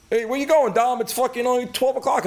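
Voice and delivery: lightly voiced uncle hollering across the club; uncles calling out. Uncles teasing Dom for leaving early, a mock holler.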